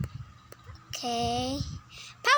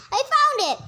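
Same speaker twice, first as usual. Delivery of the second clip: excited; 8 semitones higher, 5 dB louder than usual. Acted. Excited find in roleplay game.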